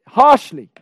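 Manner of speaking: loud, high emphatic burst; harsh. Shown as a demonstration. Teacher describes how God speaks to Moshe, harsh. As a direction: punch it out harshly. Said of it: "He speaks to him in a harsh language".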